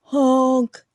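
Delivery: big loud honking horn. A narrator's reading. Dump truck yelling at everyone, loud honk. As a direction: blast it like a bossy truck horn. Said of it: "yelled a dump truck".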